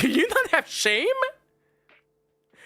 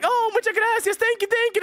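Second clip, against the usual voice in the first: higher-pitched, gushing lady impression; imitated grateful lady; 8 semitones higher than usual. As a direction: mimic her thanks in a raised voice. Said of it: "And then she's like".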